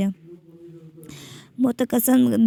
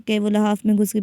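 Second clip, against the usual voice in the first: usual speaking voice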